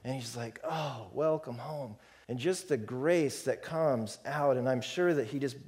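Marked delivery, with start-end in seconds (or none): 0.6-2.0 slow, soft and tender welcome home; soft, slow fatherly welcome. Acted. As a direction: greet him gently, like a father forgiving everything. Father greeting returning son.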